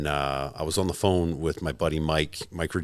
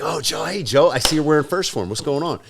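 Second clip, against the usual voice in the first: higher, eager hello; excited greeting; 8 semitones higher than usual. Retold or imitated. Retelling how strangers greet him over a shared logo, excited. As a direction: hail a new acquaintance like an old friend.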